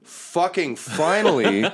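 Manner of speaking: high, slow, exasperated relief; high-voiced woman warrior, fed up; armored woman. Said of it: "the armored woman is like".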